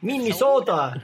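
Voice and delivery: put-on long-O Minnesota accent on "Minnesota". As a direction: stretch out "Minnesota" with a northern O. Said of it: "do that northern accent really well".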